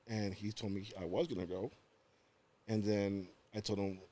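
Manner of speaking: ordinary talk, nothing marked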